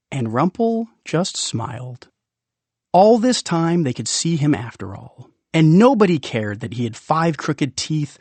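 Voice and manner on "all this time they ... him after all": plain reading voice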